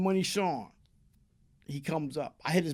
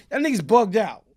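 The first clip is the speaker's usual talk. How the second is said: irritated; 2 semitones higher, 7 dB louder than usual. Retold or imitated. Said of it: "I'm getting kinda tight".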